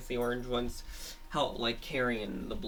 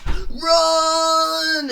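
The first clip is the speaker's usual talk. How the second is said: high, loud raspy man's yell; raspy male voice; 16 dB louder than usual. Acted. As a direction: shout Run like a rough man. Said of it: "a raspy male voice".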